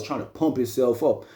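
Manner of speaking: ordinary delivery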